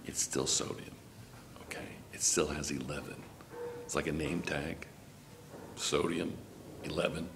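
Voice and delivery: hushed, conspiratorial teacher's aside; hushed secret. Pretend. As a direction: drop to a near-whisper, as if keeping a secret. Keeps sodium's secret from it.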